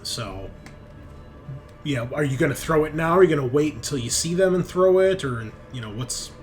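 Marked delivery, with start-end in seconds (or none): none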